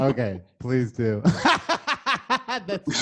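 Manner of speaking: loud cackling reaction; cracking up. Real, not acted. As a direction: laugh helplessly through the words. Cracking up at shared image.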